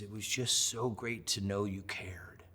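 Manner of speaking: soft, slow, understated tearful quote; imitated tearful fan. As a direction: gently imitate a moved, crying fan. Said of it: "she's crying".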